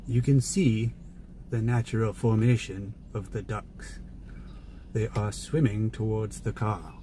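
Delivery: slow, hushed British nature narrator; measured British documentary narration, trailing softly; David Attenborough. Acted. Streamer imitating the nature show guy at the duck pond, British narrator. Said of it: "David Attenborough".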